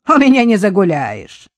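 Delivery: громко и самодовольно; похвастаться перед подругами; бойко хвалится. Acted. (продавщица громко хвалится перед покупательницами)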